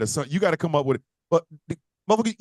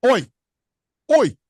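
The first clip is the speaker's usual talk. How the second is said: mock British oi; 5 semitones higher, 7 dB louder than usual. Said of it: "the oys is the only thing I understand".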